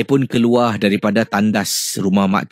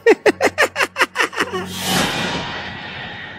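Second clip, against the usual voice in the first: very high, long eerie cackle; ghostly shrieking cackle. Shown as a demonstration. Ghost aunt starting to shriek with laughter, eerie cackle. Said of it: "bila dia dah mula mengilai".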